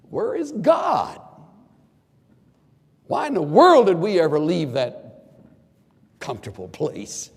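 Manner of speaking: hammed-up whining about leaving; mocking grumble. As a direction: ridicule the complainers with a whiny cry.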